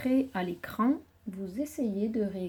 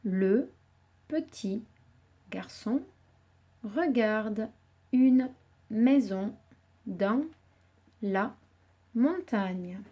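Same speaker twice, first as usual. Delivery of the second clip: the sentence read one word at a time with pauses; slower than usual. Shown as a demonstration. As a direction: reread each word slowly, pausing. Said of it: "nous venons d'écrire". (relecture mot par mot, avec pauses)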